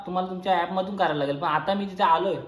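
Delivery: ordinary talk, nothing marked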